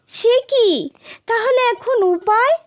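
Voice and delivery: high-pitched alarmed question; startled and worried; shocked concern. A narrator's reading. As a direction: react with dismay, voice jumping up. Aunt hears of his coming death.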